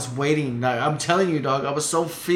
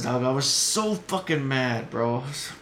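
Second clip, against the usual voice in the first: ordinary delivery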